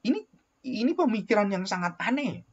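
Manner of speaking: ordinary talk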